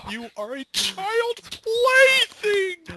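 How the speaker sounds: very high, slow hammed-up taunt; cartoon taunt. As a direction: squeal the insult out slowly.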